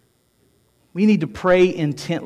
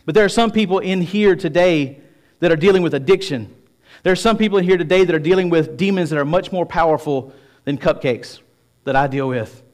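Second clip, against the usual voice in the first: usual speaking voice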